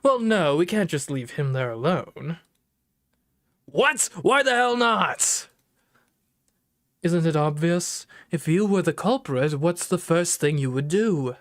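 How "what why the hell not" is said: angry. Acted. Angry tough guy reacts.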